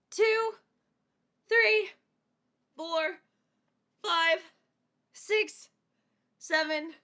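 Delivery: counting reps while exercising; doing jumping jacks. Real, not acted. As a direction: count each jump, a bit breathless. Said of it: "I'm jumping jacks right now".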